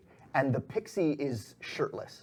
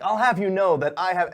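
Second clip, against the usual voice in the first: high, loud, indignant retort; loud indignation, pitched up; indignant protest; 7 semitones higher, 9 dB louder than usual. Acted. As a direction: protest loudly in a raised pitch. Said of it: "she's like".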